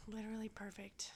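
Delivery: breathless whispered swoon; whispering. Real, not acted. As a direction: whisper it dreamily. Dreamy aside about Jamie.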